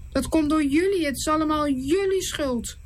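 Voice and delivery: lightly voiced blame; complaining leaders. Leaders blaming Moses and Aaron, mildly complaining. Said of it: "klagen ze".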